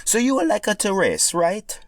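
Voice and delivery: Italian plumber asking friendly; Mario voice. Acted. Mario asking pink Yoshi about racing, Italian accent.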